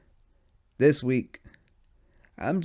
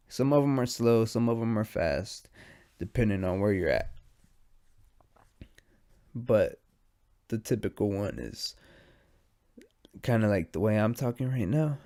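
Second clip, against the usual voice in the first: ordinary talk, nothing marked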